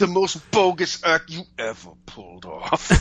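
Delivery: talking through a closed jaw; clenched teeth. Acted. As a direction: force it out with teeth shut. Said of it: "his teeth closed".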